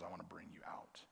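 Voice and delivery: hushed, breathy reassurance; God whispering comfort to the listener; soft whisper. Acted. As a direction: whisper it gently, as God's voice. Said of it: "and God says".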